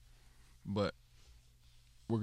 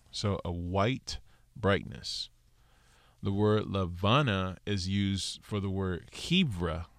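ordinary delivery

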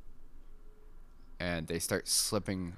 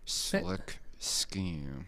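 lips barely moving; 3 dB quieter than usual. Shown as a demonstration. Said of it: "it's all in the cheeks".

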